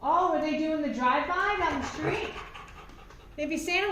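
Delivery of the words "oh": ordinary delivery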